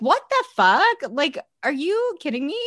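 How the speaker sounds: high incredulous outburst; exasperated disbelief at the characters; mock outrage. Podcaster reacting to the show, outraged. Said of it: "And I'm like".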